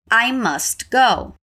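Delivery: slow, careful model read; slow repeat. Shown as a demonstration. Teacher modelling the phrase slowly for students. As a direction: say it slowly so they can repeat. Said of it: "Let's repeat".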